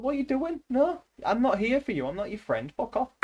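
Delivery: high-pitched exasperated retort; incredulous, high comeback; mock indignant. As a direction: act out indignantly refusing a pushy caller. Acted retort to buzzer callers.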